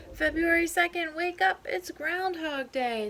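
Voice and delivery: high, loud call to wake up. Acted. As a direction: rouse them loudly. Alarm wakes groundhogs.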